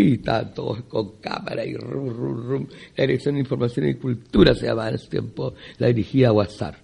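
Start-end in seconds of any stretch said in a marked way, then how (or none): none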